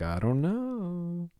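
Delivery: high, slow evasive shrug; drawn-out dodging answer; evasive actor. Retold or imitated. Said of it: "they'll be like".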